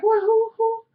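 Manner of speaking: high-pitched imitation of a flustered reaction; imitating mother's reaction. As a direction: mimic her in a high little squeak. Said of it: "She like".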